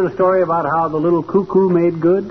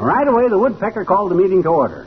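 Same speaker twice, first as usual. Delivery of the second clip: ordinary talk, nothing marked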